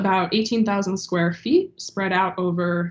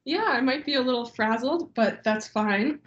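stuffy nose from a cold; speaking through a head cold; blocked nose; 5 semitones higher, 3 dB quieter than usual. Real, not acted. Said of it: "so I'm a little stuffy".

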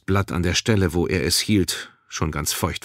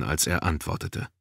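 usual speaking voice

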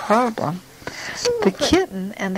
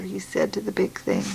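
plain reading voice

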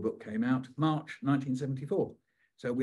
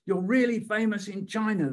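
higher and louder, eager insistence; insistent fan; 7 semitones higher, 6 dB louder than usual. Retold or imitated. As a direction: pester him to say it. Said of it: "they said".

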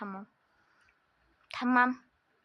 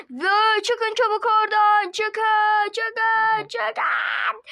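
loud, high yelling to get out; angry order; 5 semitones higher, 4 dB louder, much slower than usual. Acted. Shopkeeper chasing the girls out, yelling. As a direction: chase them out shouting.

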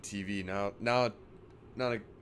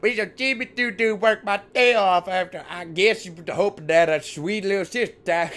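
put-on southern accent; 7 semitones higher, 6 dB louder than usual. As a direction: parrot the character in a hammed-up Southern accent. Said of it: "I can't get past that accent".

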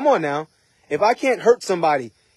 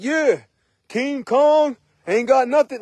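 high, slow, drawn-out boast; hammed-up overconfident bragging; mock swagger; 6 semitones higher, slower than usual. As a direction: play a cocky show-off, pitched up and stretched. Mimics an overconfident swaggering man.

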